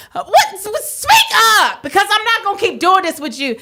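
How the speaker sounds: high, annoyed parent voice; scolding mother. Retold or imitated. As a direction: tell the child off firmly. Mother scolding crying child.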